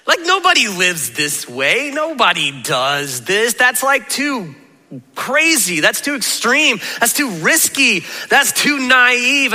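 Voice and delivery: slow, stretched-out incredulous protest; acted disbelief. Sermon audience objecting that Jesus's way is impossible, incredulous. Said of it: "people are all left sitting there going".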